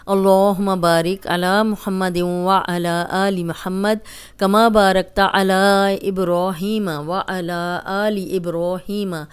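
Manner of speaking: measured, devout Arabic recitation; prayer recitation. Acted. Teacher opening the lesson, reciting a devout prayer.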